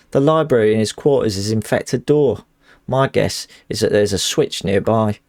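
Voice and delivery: game character's lines in Cockney; put-on Cockney with stray northernisms; Cockney accent. Streamer voicing a letter to Clarice, Cockney accent. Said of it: "my Cockney accent".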